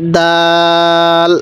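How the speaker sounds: দাল held long and slow, heavy d; drawn-out Arabic dal. Shown as a demonstration. Teacher models the letter dal for students, slow and stretched. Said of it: "দালটা শক্ত করে উচ্চারণ করব".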